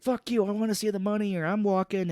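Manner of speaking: hammed-up movie threat; mock tough-guy anger. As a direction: imitate a gangster threatening to walk. Coburn's character arguing back.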